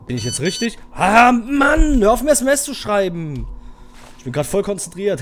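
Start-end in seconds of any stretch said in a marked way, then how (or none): 0.9-3.5 furious yell. Real, not acted. Raging at game messages.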